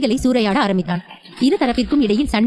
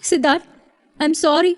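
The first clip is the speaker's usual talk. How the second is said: put-on higher, mildly tearful young wife; wife apologising at his bedside, lightly tearful; tearful apology; 6 semitones higher than usual. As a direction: apologise through a hint of tears. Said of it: "கதறி அழுதாள்".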